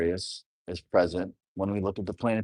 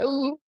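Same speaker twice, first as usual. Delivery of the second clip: high squeaky ooh of being touched; a high, tender little ooh; mock moved coo. Daughter reacting to a heartfelt I love you, high coo. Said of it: "you start to cry".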